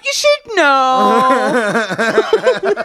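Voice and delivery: high, very slow put-on voice; mock prank caller. Prank caller finishing the gag in a high, slow voice. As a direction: draw it out sweetly like a fake caller.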